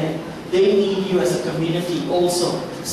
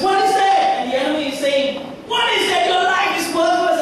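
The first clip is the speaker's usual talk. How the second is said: high, contemptuous; high sneering accuser; scornful enemy; 11 semitones higher than usual. Acted. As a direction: belittle the listener.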